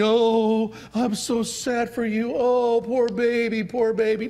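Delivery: high, slow, syrupy sympathy voice; lightly mocking baby-talk pity. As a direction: coo over them like a hurt child. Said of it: "You don't need to say".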